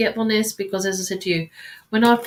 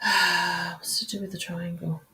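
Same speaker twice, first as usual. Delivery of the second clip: softer, slow murmur to herself; quiet musing; 2 semitones lower, 6 dB quieter than usual. Real, not acted. Psychic puzzling over Matt's card, murmured softly to herself. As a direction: turn inward and mull it over softly.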